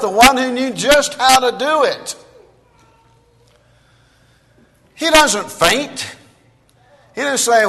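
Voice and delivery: loud, high, ringing sermon voice; full-force preaching. Acted. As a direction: thunder it out to the congregation.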